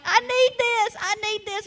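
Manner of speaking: high-pitched, urgent mock plea; excited pleading. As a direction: beg for it like an eager shopper. Acting out her excited plea in store.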